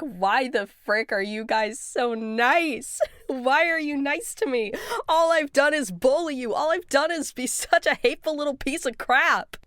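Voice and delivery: a mock Jax venting; Jax outburst. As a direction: lash out at himself in disbelief. Voicing Jax's outburst.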